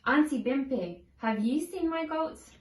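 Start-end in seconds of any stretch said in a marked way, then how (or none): none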